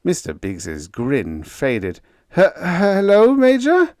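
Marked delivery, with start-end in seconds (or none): 2.3-4.0 higher stammered hello; weak falter. A narrator's reading. Biggs caught by the Major, faltering. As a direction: falter it nervously. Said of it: "he faltered weakly".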